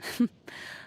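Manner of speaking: a brief quiet chuckle; low breathy laugh at her own quip; soft laugh. Acted. Duelist amused by her own reputation, soft chuckle.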